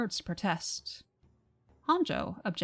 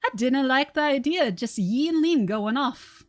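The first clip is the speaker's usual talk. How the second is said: booming Irish-Scottish brogue, louder and higher; Irish-Scottish accent; 4 semitones higher, 5 dB louder than usual. Acted. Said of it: "now I have to do the accent".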